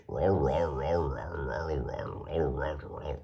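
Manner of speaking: garbled, r-heavy Beijing mumble; mock Beijing accent. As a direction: imitate Beijing speech as if chewing marbles. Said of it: "it sounds like they're chewing marbles".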